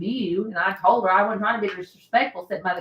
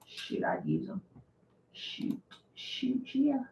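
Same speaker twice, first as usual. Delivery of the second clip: breathy whispered aside; 5 semitones higher, 11 dB quieter, slower than usual. Real, not acted. Hushed aside to herself about the labs. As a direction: whisper it under your breath.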